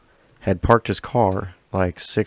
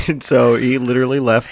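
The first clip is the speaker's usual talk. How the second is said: laughing; 5 semitones higher, 10 dB louder than usual. Real, not acted. Still laughing about story.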